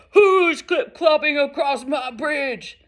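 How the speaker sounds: loud, slow, drawn-out roar; roaring troll. Acted. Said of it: "A roar rose up from below".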